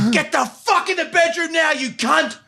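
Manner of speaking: high-pitched mock ordering; imitated angry wife. Wife ordering husband to the bedroom, high imitated rage. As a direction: imitate her cursing him out. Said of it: "That's how she spoke".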